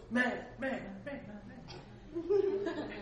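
high drawn-out mock echo, slowing as it fades; imitating an echo. Guide imitating his own voice echoing in the valley, slow fading echo. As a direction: let the word bounce back and die away. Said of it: "my voice goes".

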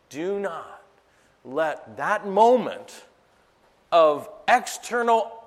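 slow, swelling to loud; slow building exhortation. Acted. Pastor urging the church not to be torn apart, slow and building. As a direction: build it word by word.

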